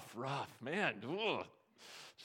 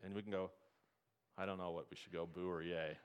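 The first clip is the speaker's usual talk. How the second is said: ordinary talk, nothing marked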